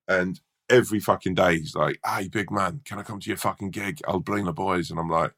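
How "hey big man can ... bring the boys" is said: lightly voiced Scottish hard man, offhand; a hint of a Scottish mate inviting himself; toned-down Scottish accent, quiet and casual; half-hearted Scottish accent. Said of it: "a Scottish accent".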